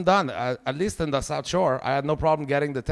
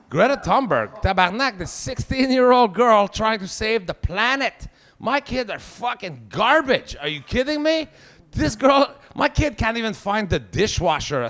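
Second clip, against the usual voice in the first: put-on fed-up father comparing his kids to Greta; exasperated rant; 7 semitones higher, 3 dB louder than usual.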